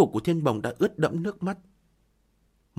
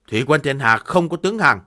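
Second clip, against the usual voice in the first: firm, louder proud refusal; defiant declaration; 8 dB louder than usual. Acted. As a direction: declare it with pride. Defiant general refuses surrender.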